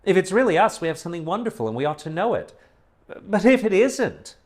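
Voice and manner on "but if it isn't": sobbing, slow and high; bursting into sobs. A narrator's reading. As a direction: voice rising high and breaking into sobs. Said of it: "she burst into sobs".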